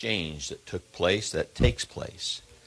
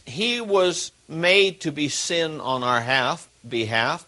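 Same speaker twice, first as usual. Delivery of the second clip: ordinary talk, nothing marked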